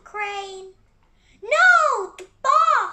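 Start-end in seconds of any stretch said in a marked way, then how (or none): 1.4-2.9 loud, sudden alarmed shout; panicked yell. Real, not acted. Box falling, panicked yell.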